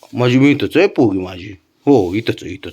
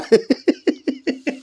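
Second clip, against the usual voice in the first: high-pitched giggling laugh; helpless high cackle. Real, not acted. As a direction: burst into a long high laugh. Amused by the dog.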